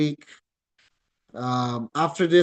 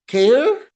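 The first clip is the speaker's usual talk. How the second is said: "care" loud with an upward sweep; emphatic rising "care"; rising accent; 10 semitones higher, 8 dB louder than usual. Shown as a demonstration. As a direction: push the pitch up through "care". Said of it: "And pataas".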